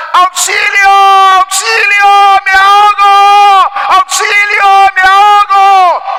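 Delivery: high, drawn-out cries for help; mock drowning shouts. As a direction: yell for rescue as the boy pretending to drown. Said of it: "comenzaba a gritar". (gritos fingidos de auxilio)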